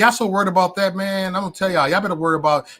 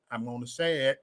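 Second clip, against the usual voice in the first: low and much softer; hushed, lower-pitched lead-in; quiet announcement; 4 semitones lower, 11 dB quieter than usual. Real, not acted. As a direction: say it under your breath. Hesitant first take before opinion.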